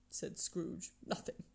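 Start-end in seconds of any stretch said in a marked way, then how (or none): 1.0-1.4 very soft, almost voiceless repeat; subdued dismissal. A narrator's reading. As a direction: let it fade away quietly. Scrooge repeats his subdued brush-off.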